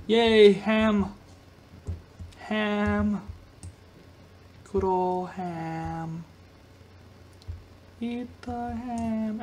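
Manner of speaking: slow, drawn-out hungry relish; savoring ham. Pretend. Gamer drooling over in-game ham, slow. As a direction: linger over every word like a feast.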